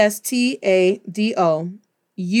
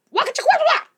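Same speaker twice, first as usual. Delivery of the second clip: high-pitched, comic waka-waka sweeping noise; rapid nonsense sound effect, very high; playful swishing noise; much faster than usual. Pretend. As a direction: squeak out a cartoon broom attack. Mimes sweeping people up with broom.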